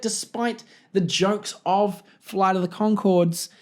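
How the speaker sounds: ordinary delivery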